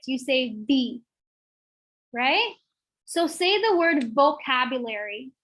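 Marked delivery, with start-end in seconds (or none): 0.7-1.0 "V" with lower lip bitten, buzzing. Shown as a demonstration. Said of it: "you bite your lower lip when you say it".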